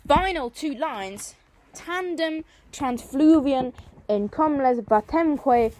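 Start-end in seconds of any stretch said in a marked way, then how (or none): 1.7-5.7 loud Latin verse recital. Shown as a demonstration. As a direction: recite the Latin lines louder, like declaiming a poem. Teacher recites Latin lines loudly.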